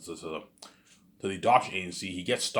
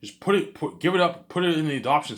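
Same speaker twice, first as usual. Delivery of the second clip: excited urging; 8 semitones higher, 6 dB louder than usual. Acted. Character urging to give baby away.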